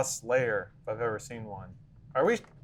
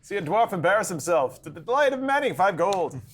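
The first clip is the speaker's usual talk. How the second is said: higher, rising crowd-hyping announcement; town crier; 6 semitones higher, 3 dB louder than usual. Pretend. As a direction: cry it out like a carnival barker. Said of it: "You gotta get a hype man, get the crowd excited".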